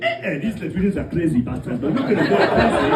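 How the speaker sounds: put-on loud African-accented lion; comic African accent, loud and animated. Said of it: "best impression of a lion".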